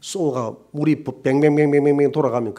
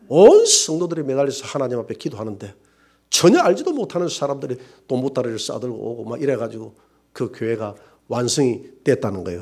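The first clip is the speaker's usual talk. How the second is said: normal talking voice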